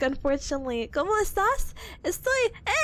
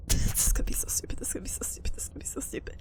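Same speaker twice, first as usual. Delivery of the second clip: rapid whisper, fading softer with each repeat; whispered aside; 6 semitones lower, 5 dB quieter, much faster than usual. Real, not acted. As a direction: whisper it to yourself like a nervous chant. Muttered aside to self before attempting.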